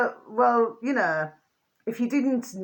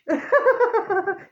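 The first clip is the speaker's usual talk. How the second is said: high giggle; 12 semitones higher, 4 dB louder than usual. Real, not acted. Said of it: "it makes you smile".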